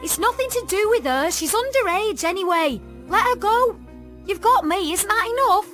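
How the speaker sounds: put-on high, loud defiant girl's voice; urgent protest. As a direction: argue loudly to get your friend released. Tanya argues to free her friend.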